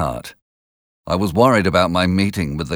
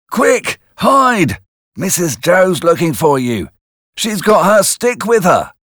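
much higher, louder warning cry; urgent warning shout; 11 semitones higher, 6 dB louder than usual. A narrator's reading. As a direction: shout a hurried warning to hide. Joe warns Pip urgently.

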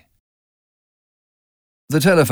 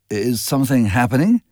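usual speaking voice

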